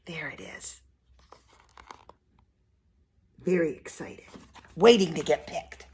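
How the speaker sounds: soft, low aside to the little listeners; hushed aside. Acted. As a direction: lean in and murmur it to the kids.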